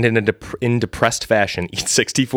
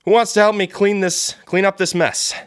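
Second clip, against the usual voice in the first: boss calling out; 10 semitones higher than usual. Acted. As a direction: call out to the workers in a high voice. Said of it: "turns to the other cheesemakers and says".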